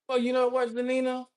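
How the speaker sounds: usual speaking voice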